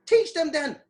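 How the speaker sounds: rally the parents to act; emphatic urging. Real, not acted.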